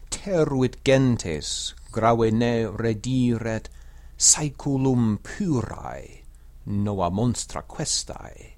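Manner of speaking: slow, measured verse reading with drawn-out long syllables; Latin poem recitation. Shown as a demonstration. Teacher recites Horace's ode for learners, slow metrical Latin. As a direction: recite the ode steadily in metre, slowly.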